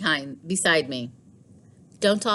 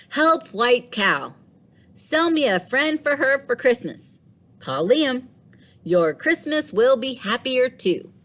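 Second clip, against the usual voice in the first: put-on higher, bright reading of a kid's sign; child's notice voice; 7 semitones higher, 3 dB louder than usual. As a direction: read it like a boy's plea. Reading Liam's notice aloud.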